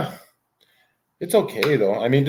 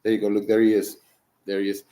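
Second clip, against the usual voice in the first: ordinary talk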